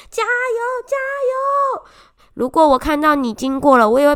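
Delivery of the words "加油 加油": cheering shout. Retold or imitated. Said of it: "很认真的在嘶吼着".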